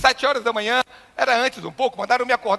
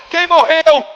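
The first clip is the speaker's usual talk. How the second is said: loud, high-pitched startled question; dramatized shocked yell; alarmed shout; 7 semitones higher, 11 dB louder, much slower than usual. Retold or imitated. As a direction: cry out as an acted alarmed mother. Mother's alarmed question in retold scene.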